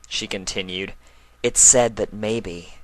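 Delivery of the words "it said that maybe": slowed, teasing suspense; spooky slow. Acted. Twilight building suspense for the foals, slow. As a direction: stretch the maybe.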